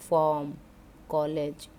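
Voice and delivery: ordinary talk, nothing marked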